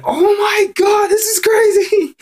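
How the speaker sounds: very high, slow, stunned squeal; shocked disbelief. Retold or imitated. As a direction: gasp it out in pure shock, way up high. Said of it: "I was like".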